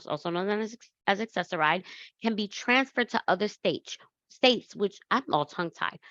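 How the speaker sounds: normal talking voice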